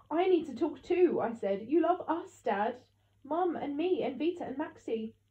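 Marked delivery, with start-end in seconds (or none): 0.1-1.2 high, loud insistence; insistent protest. A narrator's reading. Child protesting at being sent away, insistent. 1.7-5.2 high, raised-voice plea; desperate appeal. A narrator's reading. Child appealing to Dad who is leaving, desperate.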